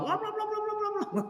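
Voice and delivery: high-pitched nagging gibberish; mock nagging babble. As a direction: squeak out the wife's nagging as blah blah. Nagging wife mimicked as babble. (omelan istri yang ditirukan tinggi)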